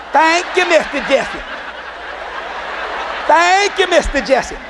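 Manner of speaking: high sing-song imitation of children; kids' chorus thanks. All the kids thanking the dad, sing-song imitated chorus. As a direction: chant it like kids in unison, starting high and falling.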